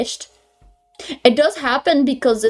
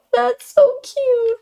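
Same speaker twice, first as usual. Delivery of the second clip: much higher, drawn-out squeal of delight; squealed cute; 4 dB louder, much slower than usual. Real, not acted. Streamer gushing at a viewer's compliment, high squeal. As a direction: gush over the sweet message.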